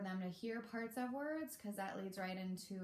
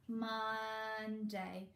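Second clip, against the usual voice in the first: slow, one beat per body part; body-part syllables. Shown as a demonstration. Teacher tapping head and shoulders, slowly. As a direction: stretch each syllable out. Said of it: "head, shoulders, knees, and toes".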